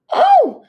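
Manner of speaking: very high, loud shriek of shock; startled scream. A narrator's reading. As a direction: yelp as her foot is grabbed. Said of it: "she screamed".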